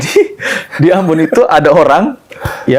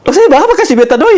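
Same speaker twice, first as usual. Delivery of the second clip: high, loud imitated Ambonese voice; raised Ambonese lilt; Ambonese accent; 12 semitones higher, 5 dB louder than usual. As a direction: mimic the old man in Ambonese dialect, high and forceful. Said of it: "Pakai logat Ambon kan".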